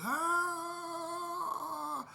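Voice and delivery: high-pitched mock holler; imitated holler. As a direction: echo the old man's holler. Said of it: "screaming as I was running".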